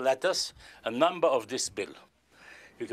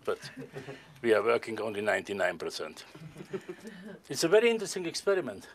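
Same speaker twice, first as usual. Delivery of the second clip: normal talking voice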